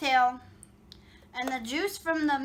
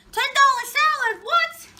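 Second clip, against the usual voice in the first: surprised outburst; 11 semitones higher, 8 dB louder than usual. Real, not acted. Shocked at the total cost.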